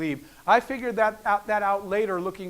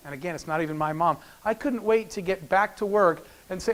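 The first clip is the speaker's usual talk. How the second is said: ordinary delivery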